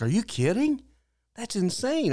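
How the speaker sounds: high-pitched incredulous reaction; amazed disbelief. Retold or imitated. Barista hearing of an eleven dollar bargain, amazed. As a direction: react astonished, pitch jumping up.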